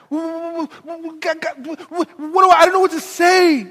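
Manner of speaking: flustered stammering Moses; stuttering Moses. Acted. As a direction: stumble over what, then blurt. Moses stuttering, per talk.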